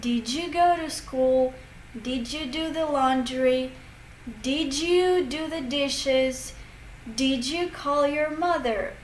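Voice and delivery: every "Did you" said as "didju"; measured example questions, "Did you" blended with a J; linked J sound. Shown as a demonstration. Teacher listing examples for learners, "Did you" as "didju". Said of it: "you can hear a sound J".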